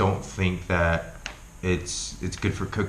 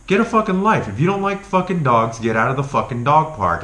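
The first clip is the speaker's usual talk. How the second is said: louder, higher exasperated outburst; angry rant; 7 semitones higher, 5 dB louder than usual. Real, not acted. Venting at the rude man, loud and fed up. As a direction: let the frustration burst out.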